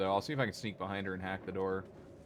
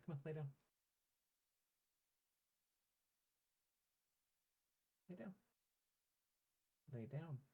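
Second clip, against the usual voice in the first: much softer, fond nagging; mildly exasperated, gently shooing the pet; soft pet scolding; 3 semitones higher, 7 dB quieter than usual. Real, not acted. Owner quietly shooing the pet off his monitor.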